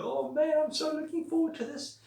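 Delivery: high, eager gush of anticipation; excited. Acted. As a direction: squeal it like you can't wait. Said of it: "Well, if I'm excited".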